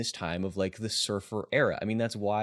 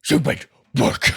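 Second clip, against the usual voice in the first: loud but held-back "Stupid work"; 5 semitones higher, 9 dB louder than usual. Shown as a demonstration. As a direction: say it loud, a bit restrained. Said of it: "not gonna say it too loud".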